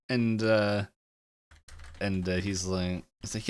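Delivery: ordinary talk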